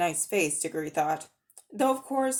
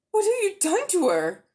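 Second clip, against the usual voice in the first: put-on high, loud frightened boy's demand; a boy crying out for his friend; alarmed boy; 11 semitones higher, 6 dB louder than usual. A narrator's reading.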